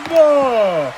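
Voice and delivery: slow, drawn-out winner announcement; ring announcer. Acted.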